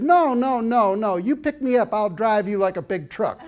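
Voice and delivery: put-on loud, high little-girl Texas protest; little Texas accent. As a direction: imitate an insistent Texas child, high and loud. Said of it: "in her little Texas accent".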